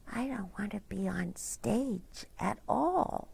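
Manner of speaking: soft unvoiced whisper. A narrator's reading. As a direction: breathe it like a shy secret. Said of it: "First banana whispers back".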